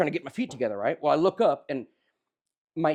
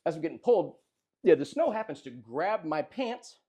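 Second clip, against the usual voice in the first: usual speaking voice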